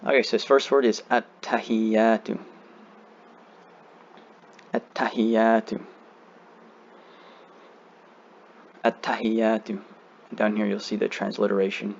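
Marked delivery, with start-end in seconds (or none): 1.1-9.8 at-tahiyyatu slowly, long vowels held; measured, syllable-by-syllable model for learners; slow recitation. Shown as a demonstration. Teacher giving the first word to repeat, slow recitation. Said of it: "saying it as slow as you can".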